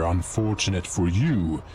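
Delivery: usual speaking voice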